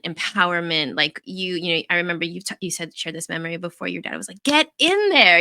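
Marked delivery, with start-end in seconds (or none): 4.4-5.4 mock dad yell, higher and louder; a hint of a pushy sports dad calling out. Friend imitating the coach's father yelling from the sideline. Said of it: "He was always so frustrated".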